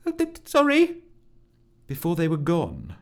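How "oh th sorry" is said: very high, feeble stammer; faltering high-pitched sorry; pathetic mumble. Acted. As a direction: squeak out a pathetic apology. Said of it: "mumble a pathetic".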